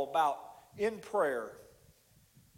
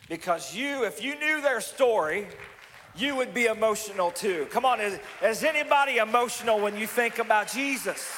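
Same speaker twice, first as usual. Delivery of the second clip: raised, louder preacher's voice; impassioned preaching; 5 semitones higher, 4 dB louder than usual. Real, not acted. Preacher urging the congregation to feel for Jesus, impassioned. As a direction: preach it high and loud to the room.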